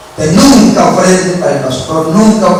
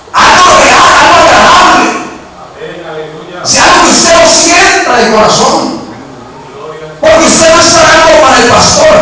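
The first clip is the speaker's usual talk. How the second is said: much higher, shouted sermon delivery; impassioned preaching; 11 semitones higher than usual. Real, not acted. Preacher urging the church on living offerings, impassioned preaching. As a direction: proclaim it phrase by phrase at high pitch.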